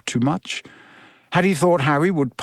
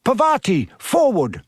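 mild, raised-pitch shout; Professor Lupin; 6 semitones higher than usual. A narrator's reading. Teacher calling a pupil forward, mild narrated shout. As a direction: call out a command without full volume. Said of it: "Professor Lupin shouted".

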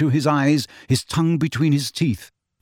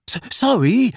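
gasped apology; 9 semitones higher than usual. A narrator's reading. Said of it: "he gasped".

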